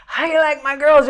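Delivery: high, loud stage shout; excited mimicked call to the audience; imitated showman's call. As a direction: whip up the crowd like an emcee. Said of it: "and you go".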